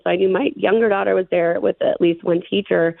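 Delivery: usual speaking voice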